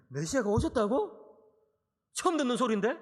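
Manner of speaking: gasp, then a surprised question; mock surprise. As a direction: play a listener hearing it for the first time. Mock listener reacting in surprise. (처음 듣는 듯 놀란 척)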